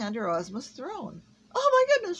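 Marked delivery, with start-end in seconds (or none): 1.5-2.2 high, loud startled cry; startled gasp. A narrator's reading. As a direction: jump in shock, pitch shooting up. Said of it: "she gasped".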